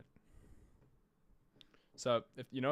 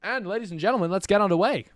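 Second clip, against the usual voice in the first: announcer intro; 6 semitones higher, 6 dB louder than usual. Acted. Caster opening the broadcast.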